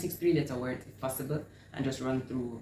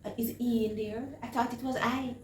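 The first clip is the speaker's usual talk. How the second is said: high-pitched puzzled learner voice; higher, protesting pupil voice; older learner; 9 semitones higher than usual. Acted. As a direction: voice a confused student querying the teacher, pitched well up. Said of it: "So one might say to you".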